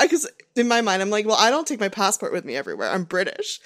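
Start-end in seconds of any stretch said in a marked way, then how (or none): 1.2-3.6 quick, offhand fake British teen voice; fake British accent. Teen buying cigarettes, fake British accent. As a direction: bluff being British, quickly and casually. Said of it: "my British accent".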